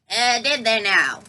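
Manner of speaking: put-on slow, cackling old crone voice; cackling witch. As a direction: sneer it like a wicked hag. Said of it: "cackled the old crone".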